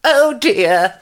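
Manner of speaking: put-on high-pitched old lady voice; high, mild dismay of an old woman; elderly lady. A narrator's reading. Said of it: "The elderly lady added".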